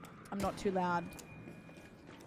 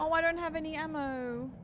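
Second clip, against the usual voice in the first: panicking gamer; 6 semitones higher than usual. Real, not acted. Out of ammo mid fight.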